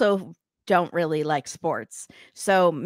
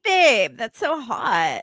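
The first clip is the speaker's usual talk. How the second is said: slow mock flirt; 4 semitones higher, much slower than usual. Parodying the couple's push-up scene.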